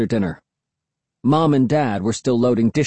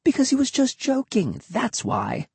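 put-on dismissive boy; 14 semitones higher than usual. A narrator's reading. Will dismisses Cassie.

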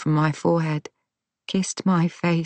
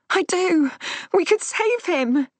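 pleading; 13 semitones higher than usual. Acted. Rose pleading, much higher voice.

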